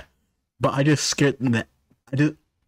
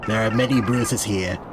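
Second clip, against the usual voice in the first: normal talking voice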